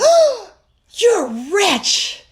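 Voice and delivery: put-on high, loud, bright child's cry of amazement; little girl's delighted squeal. Niece sees coins on floor, squeals in delight. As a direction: squeal it like a thrilled three-year-old. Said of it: "she went".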